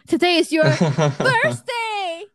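拖长音、拉高调地念出来; 像发现惊喜一样慢慢说; 惊讶地宣布. Retold or imitated. 志工惊讶地念出生日. (模仿志工惊讶地慢慢念)

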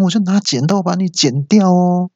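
high sing-song wife threatening to cut 剪掉; sweet menacing threat. Acted. Threat then correction. (妻子尖声甜腻地威胁“剪掉哦”)

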